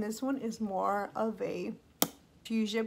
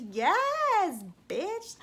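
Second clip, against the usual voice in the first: excited; 10 semitones higher, 4 dB louder than usual. Real, not acted. Hypes herself up over the color.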